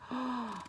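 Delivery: soft intake of breath; small gasp of wonder; amazed gasp. Acted. Reader reacts to Stanley's brave shout, amazed gasp.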